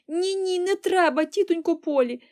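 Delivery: high, loud, urgent pleading; despairing cry. A narrator's reading. Girl begging aunt not to fix her hair, despairing cry. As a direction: cry out in alarm to stop it. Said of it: "розпачливо вигукнула Поліана".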